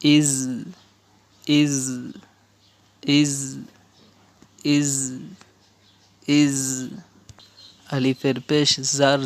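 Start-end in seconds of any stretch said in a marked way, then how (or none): 0.0-6.6 "iz" with a long buzzing z; "iz" repeated singly, with pauses; drilled "iz". Shown as a demonstration. Teacher shows alif zer za jazm, drilling "iz". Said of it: "উচ্চারণ".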